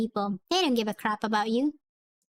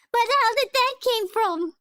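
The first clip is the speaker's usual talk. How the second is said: ordinary talk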